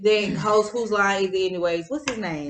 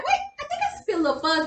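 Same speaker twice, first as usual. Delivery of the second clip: high, giggly disbelief; giggling high at the first fuzz; laughing surprise; 8 semitones higher, 5 dB quieter than usual. Retold or imitated. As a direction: laugh through the discovery. Retelling feeling hair grow back.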